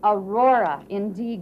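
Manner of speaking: usual speaking voice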